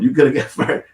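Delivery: laughing through the words. Real, not acted. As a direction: breaks into a laugh mid-line. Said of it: "You coulda got fired that time".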